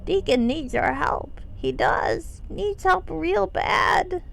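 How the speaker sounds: lightly voiced kobold, broken English; rough kobold voice. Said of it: "keep the roughness in my voice".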